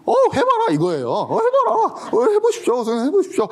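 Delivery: hohe, freche Schülerstimme, lachend nachgeahmt; frecher Schüler. Retold or imitated. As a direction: mach den herausfordernden Jungen nach. Schüler fordert Lehrer heraus.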